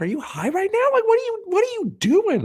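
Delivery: high incredulous mock voice; squeaky disbelief. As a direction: shriek it in disbelief. Friends mocking disbelief.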